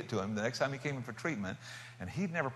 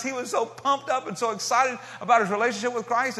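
loud, high, pumped-up telling; real thrill in the voice; excited; 9 semitones higher, 7 dB louder than usual. Real, not acted. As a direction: burst with joy at the news. Excited retelling meeting the old man.

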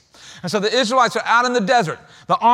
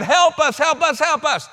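retold cry for help; 8 semitones higher than usual. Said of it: "they are crying out to God".

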